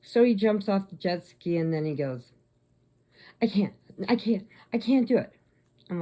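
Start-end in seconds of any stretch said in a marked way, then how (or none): 3.4-5.3 higher-pitched reluctant pleading; retold cowardly protest. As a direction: balk like a scared swimmer. Retold boyfriend balks at swimming.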